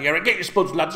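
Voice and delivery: loud, high, rowdy call to the lads; laddish cooking host. Acted. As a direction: belt it out like a laddish TV cook. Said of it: "instead of going, like, you know".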